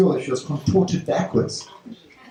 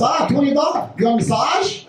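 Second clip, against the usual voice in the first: high, shouted sleazy offer; hammed-up high shouting hustler; mock hustler shout; 10 semitones higher than usual. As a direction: bark the offer up high like a greedy street hustler. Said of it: "takes it and goes".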